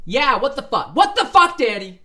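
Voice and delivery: very loud, high-pitched yelling; outraged shouting. Acted. Reader repeats outraged line in reaction.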